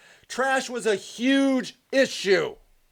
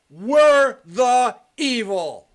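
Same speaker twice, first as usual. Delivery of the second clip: every word slowed and pressed louder; slow emphatic declaration; 5 dB louder, much slower than usual. Real, not acted. Ranting to viewers, slow loud stress on 'We're the evil'. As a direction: spell out 'We're the evil' slowly and loudly, leaning on 'We're'. Ranting at the audience about judgment.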